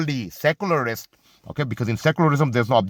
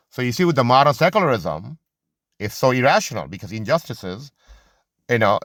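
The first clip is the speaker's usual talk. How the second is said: normal talking voice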